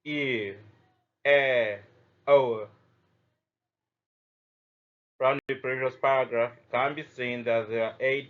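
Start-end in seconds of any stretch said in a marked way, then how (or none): 0.0-2.7 "E", "E", "O" said alone, each glide drawn out; teacher modelling centering sounds; sounding out diphthongs. Shown as a demonstration. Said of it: "and they are".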